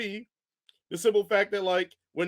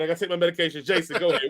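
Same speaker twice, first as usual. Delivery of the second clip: normal talking voice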